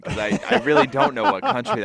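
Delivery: high, laughing through the words; laughing at the bad accent. Real, not acted. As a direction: giggle it out. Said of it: "laughing at the accent".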